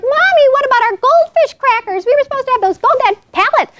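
put-on high-pitched child's protest; screaming little kid. Little kid complaining to mommy about lost crackers, mock screaming. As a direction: imitate a small kid wailing for snacks. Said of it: "are screaming like".

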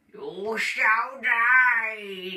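slow, loud, booming threat; menacing doom voice. Acted. Threatening his homework, slow booming doom voice. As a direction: pronounce a death sentence on the homework, drawn out.

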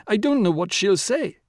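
high-pitched, mounting panic; almost hysterical. A narrator's reading. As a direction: harp on it with rising distress. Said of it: "began to harp almost hysterically".

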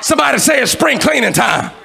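loud, high shouted sermon in driving phrases; full-force preaching. Real, not acted. Preacher exhorting the church that today is their day, full-force shouted preaching. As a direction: preach it at the top of your voice.

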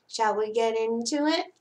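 ordinary delivery

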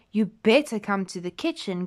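usual speaking voice

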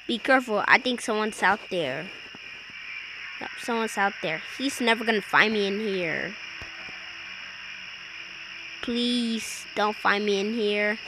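whispering while hiding. Real, not acted. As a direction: keep it hushed while hiding. Hiding from killer.

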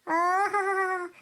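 slow, drawn-out scornful ha-ha-ha; mocking laugh. Mother mocking her son for begging his wife, slow scornful laugh. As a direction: laugh him down with contempt.

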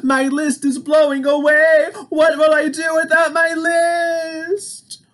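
high, loud, despairing Toad; panicked cry. Acted. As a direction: wail as the list blows away, slowing at the end. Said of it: "cried Toad".

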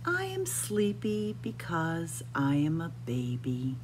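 ordinary delivery

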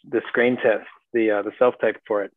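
normal talking voice

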